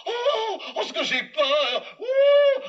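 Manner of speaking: high trembling frightened voice; high, slow panicky exclamations; terrified. Acted. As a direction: quake before the ghost. Terrified of ghost.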